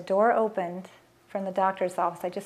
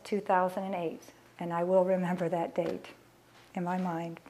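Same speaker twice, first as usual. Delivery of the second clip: ordinary delivery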